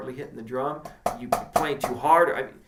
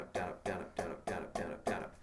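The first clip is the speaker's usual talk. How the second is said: much softer down up count; hushed count while playing; 7 semitones lower, 13 dB quieter, faster than usual. Shown as a demonstration. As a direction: breathe the count under the drums. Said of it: "demo count".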